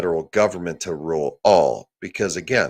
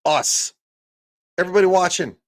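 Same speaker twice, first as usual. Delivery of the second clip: rallying call; 10 semitones higher, 4 dB louder than usual. Real, not acted. Rallying his viewers.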